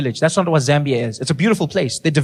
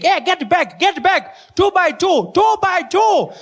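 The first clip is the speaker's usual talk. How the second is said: put-on a teacher shouting at runaway kids, high and frantic; panicking teacher; 12 semitones higher, slower than usual. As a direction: yell like you're losing control of the class. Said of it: "She's panicking, like".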